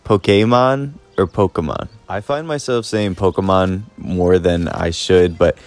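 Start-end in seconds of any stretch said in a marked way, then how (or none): 0.0-0.9 Pokemon with an AY in the middle; "Pokemon", middle vowel like kay, raised like a question; said as po-KAY-mon. Shown as a demonstration. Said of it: "Do you say". 1.3-1.9 Pokemon with a weak UH in the middle; "Pokemon", middle vowel reduced to uh; said as POKE-uh-mon. Shown as a demonstration. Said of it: "Do you say".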